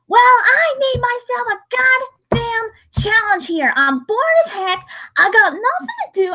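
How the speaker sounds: put-on a lazy Western Southern accent. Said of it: "put on, like, a f-, Southern accent".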